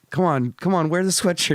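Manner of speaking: mocking imitation of a fake nice guy; mock fake-polite guy. Mocking guy's fake concern.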